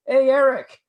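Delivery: high, loud holler into next room; retold call-out. George telling his friend the band arrived, calling out. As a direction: imitate calling a friend over excitedly.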